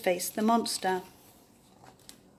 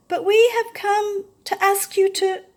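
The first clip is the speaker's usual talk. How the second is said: timid voice; 12 semitones higher, 7 dB louder than usual. A narrator's reading. Basil continues timidly.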